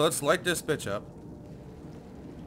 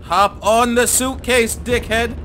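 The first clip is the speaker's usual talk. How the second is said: slow, loud high shout; angry command; 8 semitones higher, 11 dB louder, much slower than usual. Real, not acted. Player ordering the character onto the suitcase, angry. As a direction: spell each word out in anger.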